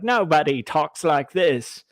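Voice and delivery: slow mock old-Hollywood leading man; Cary Grant. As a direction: stretch it out in a put-on Mid-Atlantic accent. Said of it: "did an impersonation of Cary Grant".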